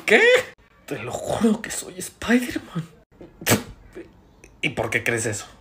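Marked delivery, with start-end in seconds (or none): 0.9-2.8 slow, soft, whispery oath; hushed insistence. Acted. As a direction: swear it slowly, half whispering. Insisting on a secret.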